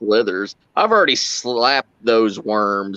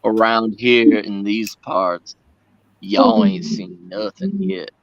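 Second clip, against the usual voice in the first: put-on Southern accent; slower than usual. Streamer imitates his old Southern accent for the stream, slow drawl. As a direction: stretch it out like a Southern good ol' boy. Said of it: "my Southern accent is what made me money".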